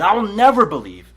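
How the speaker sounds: mock-dramatic Thomas refusing to believe. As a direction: hit never hard like a sulking doubter. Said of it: "Thomas says".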